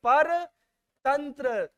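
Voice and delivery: परतंत्र very slowly, broken into पर and तंत्र; drawn-out emphasis. Shown as a demonstration. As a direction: stretch out परतंत्र part by part. Term repeated slowly for students.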